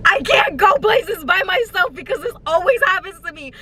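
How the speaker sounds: hammed-up sobbing complaint; mock crying. Telling viewers her woes in mock tears. Comic breakdown over bad luck.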